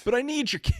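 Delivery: high-pitched mock plea; whiny send-up of a movie son; mock pleading son. Voicing the son, mocking scene.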